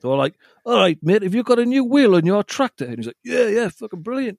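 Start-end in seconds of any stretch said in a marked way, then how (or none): 0.7-2.8 a hint of a Welsh farmer, pitched up; a weak try at Welsh locals; failed Welsh accent. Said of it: "I can't do a Welsh accent". 3.2-4.4 a hint of a Welsh farmer, pitched high; a weak stab at a Welsh farmer; lightly voiced Welsh farmer; failed Welsh accent. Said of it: "I can't do a Welsh accent".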